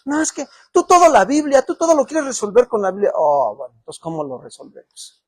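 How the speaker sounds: loud, driving sermon delivery; preaching at full force. Acted. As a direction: preach it out to the whole church. Pastor preaching loudly to congregation. (predicación a todo pulmón)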